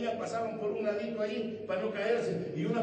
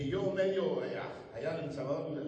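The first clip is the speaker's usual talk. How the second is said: ordinary talk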